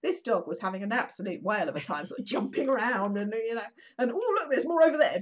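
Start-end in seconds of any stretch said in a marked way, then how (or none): 4.1-5.2 higher, louder, excited dog; playful dog voice. Retold or imitated. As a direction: give the dog's glee.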